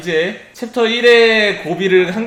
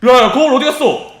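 excited shout; 7 semitones higher, 9 dB louder than usual. Real, not acted. Startled, mirror vanished.

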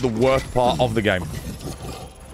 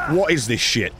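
ordinary talk, nothing marked

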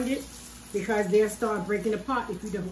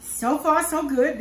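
higher and louder, pleased the cakes hold; a bit excited; 5 semitones higher, 7 dB louder than usual. Real, not acted. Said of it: "So I'm a mite bit excited".

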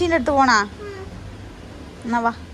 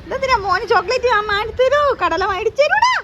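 mock scolding; 11 semitones higher, 6 dB louder than usual. Real, not acted. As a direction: scold him with playful exasperation. Mother mock-scolding son.

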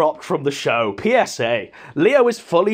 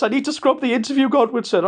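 quick, higher mock panic; imitating panicky Leo; 6 semitones higher, faster than usual. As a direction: rattle it off like a flustered nerd. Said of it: "Leo's panicking, aghast, breathless".

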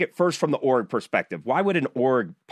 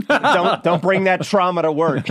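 laughing through the words, louder and higher; 7 semitones higher, 6 dB louder than usual. Real, not acted. Cohost laughing at the guest's trauma joke, amused. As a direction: laugh while scolding a friend playfully.

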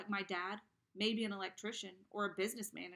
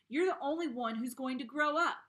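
loud, raised voice thrown at Sarah; shout; 5 semitones higher, 8 dB louder than usual. A narrator's reading. Boy shouts at Sarah in frustration. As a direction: yell it out in frustration. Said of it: "I shout".

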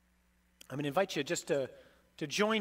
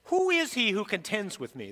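Jesus's challenge, louder and higher; voicing Jesus; 5 semitones higher, 5 dB louder than usual. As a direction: ring it out as the risen Lord. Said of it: "This is Jesus talking".

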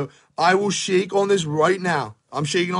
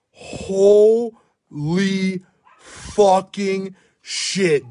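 stunned exclamation; 2 semitones higher, much slower than usual. Real, not acted. Stunned by a pull.

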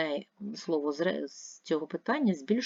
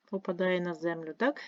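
ordinary delivery

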